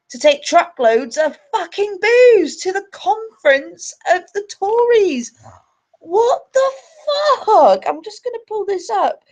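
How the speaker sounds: high-pitched outraged ranting, voice soaring; so angry. Real, not acted. Streamer ranting to viewers about booze for the Tories, real angry outrage. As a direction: rail in real, incredulous fury. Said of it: "Sorry, I'm so angry".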